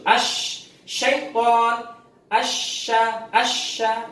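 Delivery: Ash, syaiton, asya with hissing sh air; spreading sh demo. Shown as a demonstration. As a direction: push air out on the sh. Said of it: "itu tafashi itu". (contoh desis sy yang menyebar)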